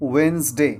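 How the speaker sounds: slow "Wednesday" as wenz-day; correct pronunciation. Shown as a demonstration. Teacher sums up the right word, slow "wenz-day". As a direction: say "Wednesday" slowly in two syllables, d silent. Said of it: "So it's Wednesday".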